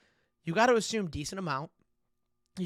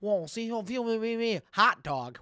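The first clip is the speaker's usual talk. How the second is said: mock French accent; 4 semitones higher than usual. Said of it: "French people ... saying".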